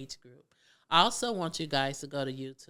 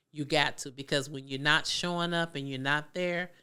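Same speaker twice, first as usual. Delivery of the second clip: ordinary talk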